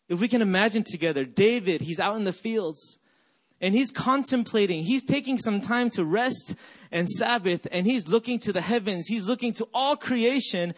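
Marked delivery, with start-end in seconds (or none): none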